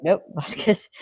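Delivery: normal talking voice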